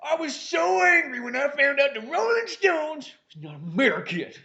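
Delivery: high-pitched hammed-up outrage; mock indignation. Patriot learns Rolling Stones aren't American, mock indignation. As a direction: complain like a wounded patriot. Acted patriot says he was angry.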